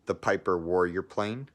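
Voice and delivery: plain reading voice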